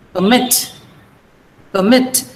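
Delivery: Permit with MIT stressed, PER short and light; per-MIT, second syllable held stronger. Shown as a demonstration. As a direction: say Permit as a verb, weight on mit. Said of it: "You are stressing mit here".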